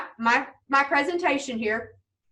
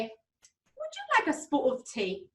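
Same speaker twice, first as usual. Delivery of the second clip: mock British accent, drawn out and softer; 2 semitones higher, 6 dB quieter, slower than usual. Imitating Mom offering tea to make us laugh, British accent. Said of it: "in a British accent".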